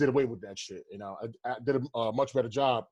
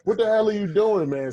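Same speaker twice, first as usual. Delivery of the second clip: loud, higher, indignant challenge; retold exasperated demand; 6 semitones higher, 9 dB louder than usual. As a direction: retell calling out a heckler, raised voice. Retelling his outburst at a heckler.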